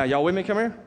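ordinary talk, nothing marked

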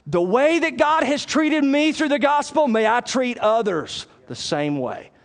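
impassioned pulpit delivery; forceful preaching. Real, not acted. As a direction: preach it out with conviction, pitched high.

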